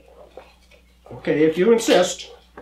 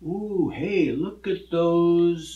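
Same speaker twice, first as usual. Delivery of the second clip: cowboy; 4 dB quieter, much slower than usual. Acted. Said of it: "he might say".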